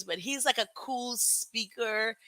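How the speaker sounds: ordinary delivery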